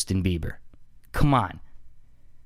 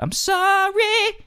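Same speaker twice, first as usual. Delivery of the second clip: high squeaky taunting voice. Retold or imitated. Mock [name] singing then taunting.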